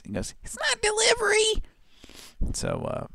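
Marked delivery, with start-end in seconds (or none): 0.4-1.6 very high, piercing cry; high-pitched irritating voice. Retold or imitated. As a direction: squeal it like the ad guy. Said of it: "he has this really high-pitched, irritating voice".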